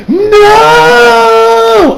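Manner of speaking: long, high, loud "no" wail; hammed-up dramatic scream; exaggerated cry. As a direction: wail it like a melodramatic anime hero. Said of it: "obviously not that exaggerated".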